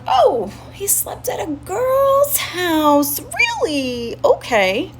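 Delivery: high, stretched-out mock surprise; sarcastic disbelief. Reacting to friend's news he slept at a girl's, sarcastic disbelief. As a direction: draw it out high, dripping with incredulity.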